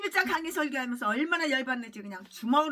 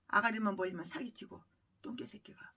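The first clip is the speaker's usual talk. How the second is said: hissed whisper under the breath; 4 semitones lower, 8 dB quieter than usual. Real, not acted. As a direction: spit the insult in a whisper. Muttered insult aside. (속삭이듯 내뱉는 욕설)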